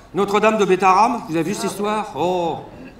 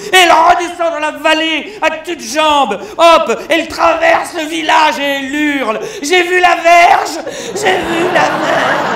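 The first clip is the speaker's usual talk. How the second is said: much higher, louder, slow shouted cry; hammed-up yelling; 6 semitones higher, 7 dB louder than usual. Comedian yelling Bernadette's punchline through the village. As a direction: yell it running down the village, laughing.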